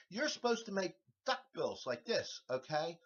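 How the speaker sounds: ordinary delivery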